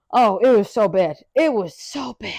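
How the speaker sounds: ordinary talk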